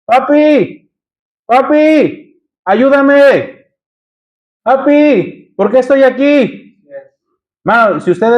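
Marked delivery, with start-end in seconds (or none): 0.0-6.6 higher, pleading cries to a father; anguished cry to father. Retold or imitated. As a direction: cry out "Papi" higher, begging for help. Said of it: "está gritándole a su padre".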